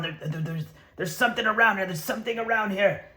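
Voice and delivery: scrambling for a way out; panicky. Acted. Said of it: "I just start panicking".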